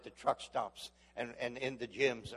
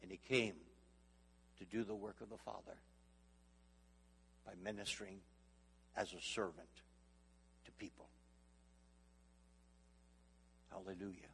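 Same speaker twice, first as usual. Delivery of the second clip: fading into hush; 4 semitones lower, 12 dB quieter than usual. Acted. Preacher softening to a reverent hush. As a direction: lower and much softer, trailing away.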